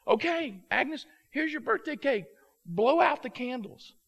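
high, loud cake presentation; excited announcing. Retold or imitated. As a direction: present the cake loudly to the room. Harry presents cake to the room.

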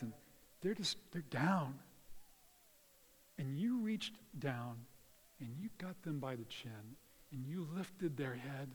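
ordinary talk

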